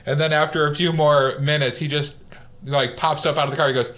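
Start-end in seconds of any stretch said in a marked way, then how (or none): none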